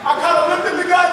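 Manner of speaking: ordinary talk, nothing marked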